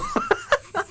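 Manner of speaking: very high squeak of a laugh; high-pitched giggle squeak; squeaky laugh. Real, not acted. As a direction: let a tiny squeal of laughter out. Ends story laughing.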